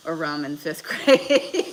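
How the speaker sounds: words giving way to a loud laugh; breaking into laughter. Real, not acted. Streamer reads viewer's vodka-gargle story, laughing. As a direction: read it out and crack up at the end.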